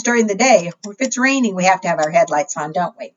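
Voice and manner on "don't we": normal talking voice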